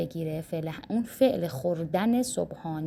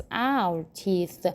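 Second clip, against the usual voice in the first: slow model phrase. Shown as a demonstration. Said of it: "building sentence".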